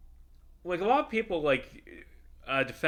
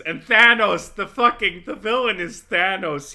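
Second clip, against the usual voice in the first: suddenly shouting the name, much louder and higher; excited shout; 8 semitones higher, 12 dB louder than usual. Real, not acted. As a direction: blurt it out, thrilled. Excited naming of the villain.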